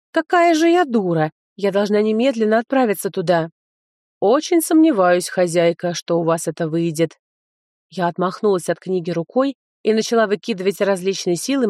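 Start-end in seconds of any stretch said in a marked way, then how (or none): 0.1-3.5 excited exclamation. Acted. Heroine realizes, exclaims. 4.2-7.1 skeptical. Acted. Book doubts heroine.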